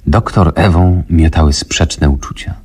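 plain reading voice

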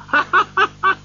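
high, rolling run of laughter; gleeful laughter. A narrator's reading. Scrooge delighted by his secret turkey, laughing on. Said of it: "He went on laughing".

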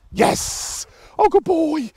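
excited praise. Real, not acted. Trainer rewards the dog's recall, excited praise. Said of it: "the praise and the vocal inflection".

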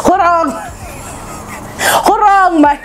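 cracking up mid-phrase; words breaking into breathy, high laughter; laughing. Real, not acted. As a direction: barely get it out for laughing. Cracks up mocking the man's words.

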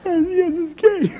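high, loud, panicky insistence; frantic wife. Retold or imitated. Wife insisting to husband, high, loud and frantic.

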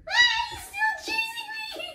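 high panicked shout; panicked yell. Real, not acted. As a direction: yell while fleeing. Chased in game.

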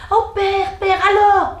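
put-on high, excited girls' voices; eager daughters. A narrator's reading. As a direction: greet father with eager excitement. Daughters greet father eagerly.

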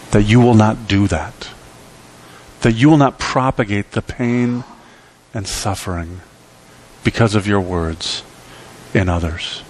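usual speaking voice

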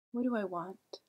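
ordinary talk, nothing marked

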